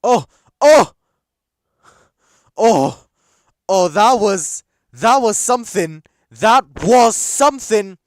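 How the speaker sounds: loud, high cries at a near miss; loud, high shouts of disbelief at a near goal; real excited shout. Real, not acted. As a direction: yell it out as the chance goes by. Near miss while watching match.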